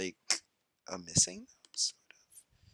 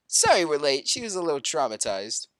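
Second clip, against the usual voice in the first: normal talking voice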